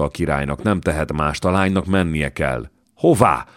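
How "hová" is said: loud, high yelled question; a son's loud demanding shout. A narrator's reading. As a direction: roar it at his father. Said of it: "üvöltött a fiú".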